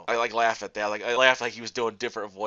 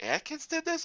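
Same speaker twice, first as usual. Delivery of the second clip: a much higher, incredulous question; surprised disbelief; 4 dB quieter than usual. Retold or imitated. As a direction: pitch it up in amazement at the news. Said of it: "I'm like".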